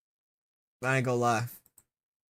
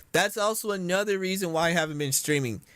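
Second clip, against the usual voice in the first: ordinary talk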